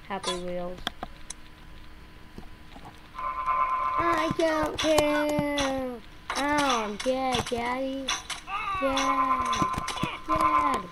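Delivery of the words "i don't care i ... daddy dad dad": high, long drawn-out cries to dad; whining at dad. Acted. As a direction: play a kid calling out to Daddy. Acting out calling to dad in game.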